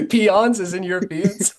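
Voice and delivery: amused laughing query. Real, not acted. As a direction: giggle out the question. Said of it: "laughing question".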